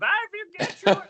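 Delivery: put-on high, loud goofy German accent; mock German accent. Buddy in the studio jokes in a mock German accent. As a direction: ham up a German accent for laughs. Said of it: "German accents".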